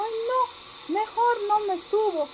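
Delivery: high, timid bunny voice; backing away from the shaking tree; scared little rabbit. Acted. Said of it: "miró al árbol y dijo". (conejito asustado, voz aguda)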